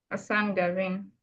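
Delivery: normal talking voice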